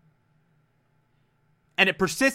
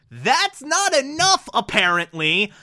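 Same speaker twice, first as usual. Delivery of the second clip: sarcastic; 6 semitones higher than usual. Retold or imitated. Sarcastic mock.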